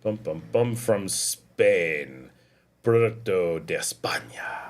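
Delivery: slow, low wine commercial voice; dramatic ad read. Acted. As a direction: read the label like a luxury ad. Reads wine label dramatically.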